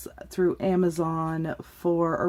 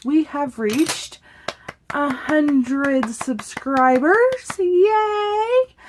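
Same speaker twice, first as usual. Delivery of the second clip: excited; 7 semitones higher, 4 dB louder, much slower than usual. Real, not acted. Announcing a hundred subscribers.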